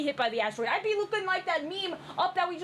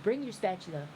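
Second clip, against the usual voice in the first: low, quiet mock threat; mock fight challenge; 11 semitones lower, 6 dB quieter than usual. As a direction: drop low and issue the challenge.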